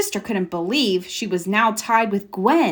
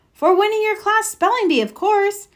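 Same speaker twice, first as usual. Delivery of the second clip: excited; 12 semitones higher than usual. Acted. Excited friend congratulating.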